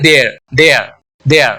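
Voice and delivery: "there" as they're, one syllable; contracted form. Shown as a demonstration. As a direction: run they and are together as "there". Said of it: "आप बोलने के समय क्या करोगे". ("there" यानी they're, मिलाकर बोलें)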